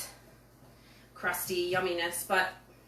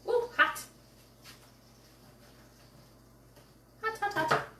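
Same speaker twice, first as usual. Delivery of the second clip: ouch, hot; 11 semitones higher than usual. Real, not acted. Touching hot tortilla.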